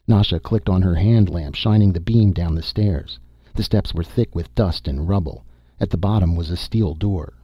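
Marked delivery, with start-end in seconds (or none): none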